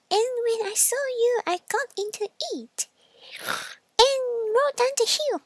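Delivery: high, loud, speeding up; triumphant piggy boast; gloating little pig. Acted. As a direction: pig mocking the frightened wolf.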